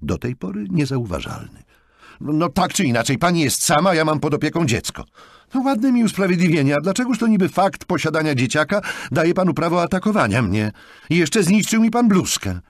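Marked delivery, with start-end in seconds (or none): 2.2-5.0 louder, high-pitched self-justifying retort; defensive, raised-voice comeback; argumentative. A narrator's reading. Acted quarrel over a table. 5.5-12.6 loud, high, indignant tirade; sarcastic outrage turning to grievance; aggrieved. A narrator's reading. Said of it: "dodała Madeleine z żalem".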